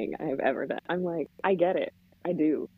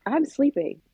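high-pitched, exasperated morning grumble; mock-irritated parent, pitched up; irritated mom; 7 semitones higher than usual. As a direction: send the kids away, fed up. Retold irritated mother sending kids away.

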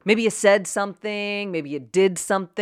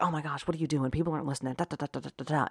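much softer, self-conscious aside; self-conscious inner chatter; 10 dB quieter, much faster than usual. Retold or imitated. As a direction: run it on under your voice like nagging thoughts. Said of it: "super self-conscious and be like".

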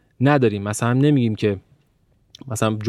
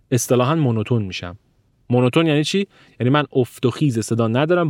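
usual speaking voice

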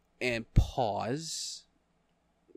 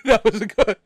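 high, loud, through real laughter; cracking up while praising a joke; laughing; 8 semitones higher, 10 dB louder than usual. Real, not acted.

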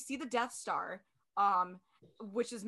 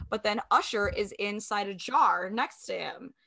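ordinary delivery

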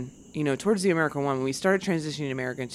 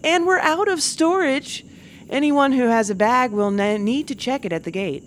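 gate agent announcement; 8 semitones higher, 4 dB louder than usual. Retold or imitated. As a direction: announce full bins over the PA. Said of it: "they're like".

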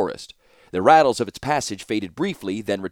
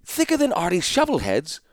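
scoffing, pitched-up character retort; mocking retort; 10 semitones higher than usual. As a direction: throw back a scornful jab.

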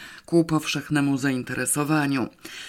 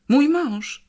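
much higher, louder strangled outburst; choked cry; 11 semitones higher, 5 dB louder than usual. A narrator's reading. Interviewer jumps up about her husband, choked high cry. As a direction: blurt it out choking on it. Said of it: "krzyknęła zdławionym głosem".

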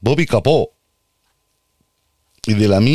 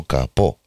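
Capó with the stress on the final O, ca-PÓ; Capó stressed like the car bonnet, ca-PÓ; 7 semitones lower than usual. Shown as a demonstration. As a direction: lean on the last syllable of Capó. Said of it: "con acento en la O".

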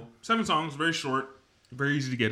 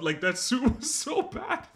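villain voice; 12 semitones higher, much slower than usual. Acted. Continues mock Juggernaut voice.

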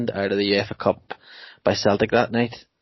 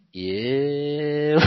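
laughing yeah; 5 semitones higher, 8 dB louder than usual. Retold or imitated. Retelling crowd reaction.

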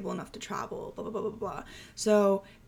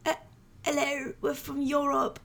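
imitating tourists with a shaky French accent; bad French accent; 5 semitones higher than usual. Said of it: "I can't do a French accent".

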